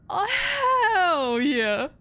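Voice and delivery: hammed-up loud, drawn-out cheer; loud whoop. Demon of her echolalia taking over, hammed-up whoop. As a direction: belt it out, stretched and falling. Echoing a phrase stuck in head.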